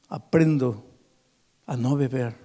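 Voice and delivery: ordinary talk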